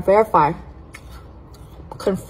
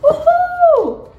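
very high, loud whoop; cheering; 4 dB louder than usual. Real, not acted. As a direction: whoop with joy. Excited by new flavor.

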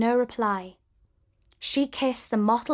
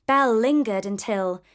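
ordinary delivery

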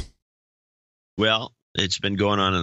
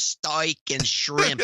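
higher, slow bad Aussie accent on steak and shrimp; mock Australian accent; 6 semitones higher, much slower than usual. Host teasing with the criticized phrase, bad Australian accent. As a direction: stretch it in a terrible Australian accent. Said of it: "really pronouncing it horribly".

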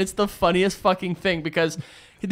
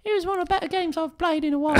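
much higher, soft, quick mock Beckham voice; imitating David Beckham; 10 semitones higher, 6 dB quieter, faster than usual. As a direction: rattle it off high and soft as Beckham. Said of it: "He'd be like".

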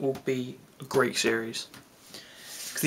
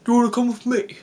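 higher, acted trailer-line quote; hammed-up quote, pitched well above usual; Christopher Eccleston; 8 semitones higher, faster than usual. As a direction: mimic an inviting line from a trailer. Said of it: "with Christopher Eccleston goes".

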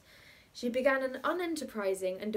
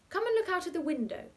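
coaxing aunt; 10 semitones higher, 4 dB louder than usual. Acted. Aunt distracts the boy.

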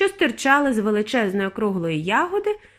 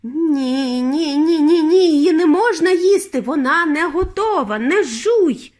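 raised, loud frantic plea; urgent warning; 6 semitones higher, 6 dB louder, slower than usual. A narrator's reading. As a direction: cry out a panicked warning, higher and louder.

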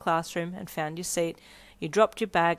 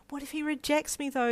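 wailing; 8 semitones higher than usual. Acted. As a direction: wail it plaintively. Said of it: "you wailed".

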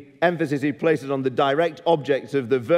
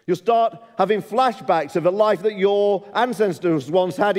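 preaching fervour; 6 semitones higher than usual. Acted. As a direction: drive the warning home hard. Preaching in God's voice, rising.

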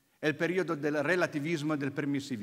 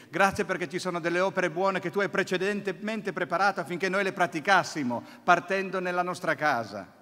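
fervent prayer; 2 semitones higher, 4 dB louder than usual. Acted. Preacher praying to the Father before the congregation, fervent. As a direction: pray it out with fervour.

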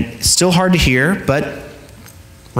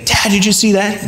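put-on excited child; 8 semitones higher than usual. Chatty daughter in car.

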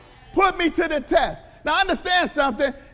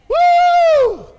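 high, loud preacher's whoop; exultant woo; 9 dB louder than usual. Real, not acted. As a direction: let out a joyful shout.

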